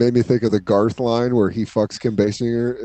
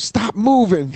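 high mock scold; 7 semitones higher, slower than usual.

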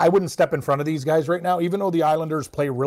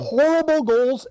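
exasperated; 9 semitones higher, slower than usual. Real, not acted. Mocking the goalie's blunders.

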